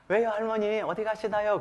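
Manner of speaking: put-on 가시나요 as the guard's polite question, which grandma hears as 가시나 (girl); misheard word. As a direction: ask it innocently, leaning on 가시나요. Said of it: "어쩔 줄 모르다가".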